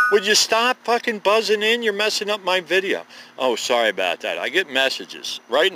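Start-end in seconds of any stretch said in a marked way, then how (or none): 0.1-3.0 annoyed outburst. Real, not acted. Snaps at buzzing phone messages.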